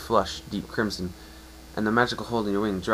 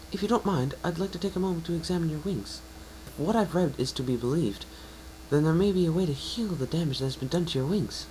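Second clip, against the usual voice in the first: higher, eager scholarly mare voice; unicorn mare; 8 semitones higher than usual. Acted. Unicorn mare telling the pegasus about Star Swirl, eager and higher. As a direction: share the history with growing excitement.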